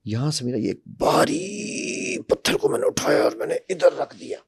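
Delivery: straining as if the stone is really heavy; felt and weighty. Shown as a demonstration. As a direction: lift the heavy stone in the voice, words pressed with effort. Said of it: "वजन आया है".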